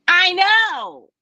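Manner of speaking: blunt high I know; high, loud retort. Retold or imitated. Her flat retort.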